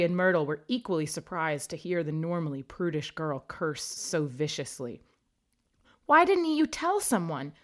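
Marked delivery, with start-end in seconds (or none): 6.1-7.5 high, loud demanding question; angry question. A narrator's reading. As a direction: scold her for keeping silent. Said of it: "she asked angrily".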